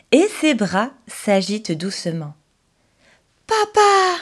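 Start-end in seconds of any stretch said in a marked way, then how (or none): 3.5-4.2 high, loud wake-up call of joy; joyful cry. Acted. Pinocchio wakes and calls his father, joyful cry. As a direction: cry out to papa, delighted. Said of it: "s'écrie Pinocchio".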